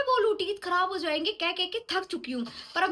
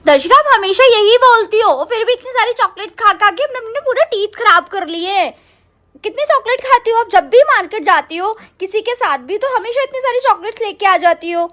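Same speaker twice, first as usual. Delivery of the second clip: loud scolding; 13 dB louder than usual. Acted. Mother scolds child over chocolate.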